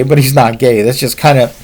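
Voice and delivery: usual speaking voice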